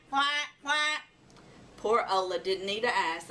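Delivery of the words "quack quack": duck quack. Acted. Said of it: "with a loud quack".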